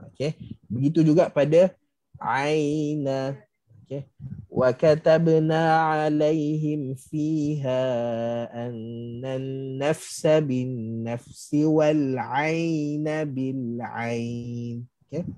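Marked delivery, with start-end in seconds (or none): 4.5-14.8 slow, drawn-out melodic recitation; Quran recitation. Shown as a demonstration. Teacher recites the verse to show aina. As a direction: chant the verse slowly with full tajwid.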